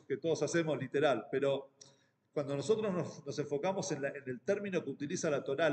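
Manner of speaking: ordinary talk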